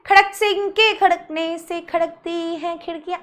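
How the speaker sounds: even, level tongue-twister delivery; tongue-twister recital. Shown as a demonstration. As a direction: recite it softly on one level note.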